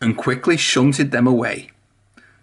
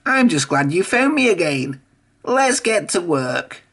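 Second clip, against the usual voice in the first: Whiff's voice; 5 semitones higher than usual. A narrator's reading. Whiff answers Emily.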